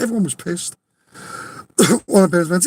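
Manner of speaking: normal talking voice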